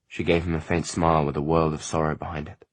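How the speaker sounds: plain reading voice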